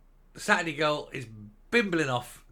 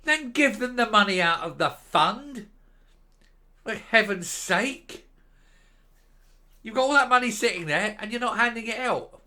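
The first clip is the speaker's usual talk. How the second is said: exasperated shout; 6 semitones higher, 4 dB louder than usual. Real, not acted. A commentator answering the government spokesman, exasperated loud shout. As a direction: spit it out in disbelief, louder and higher.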